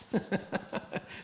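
soft unvoiced chuckle; a quick airy laugh at the story; breathy laugh. Real, not acted. Preacher laughing at the disciples' disbelief, breathy.

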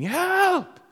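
high-pitched, loud drowning cry; screaming for help. Retold or imitated. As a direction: re-enact a child's panicked scream from the water.

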